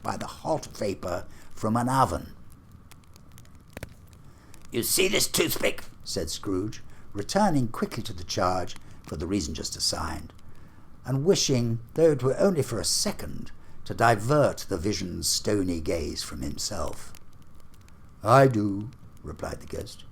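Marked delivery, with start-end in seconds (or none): 4.7-5.8 a lightly voiced old miser, raised pitch; nervous old man asking, a touch higher; Scrooge voice. Scrooge shows the ghost a toothpick, nervous old miser. 18.2-18.9 solemn ghost, louder; a grave spirit, deliberate. Acted. Marley's ghost answers Scrooge, solemn and grave. Ghost voiced in read story.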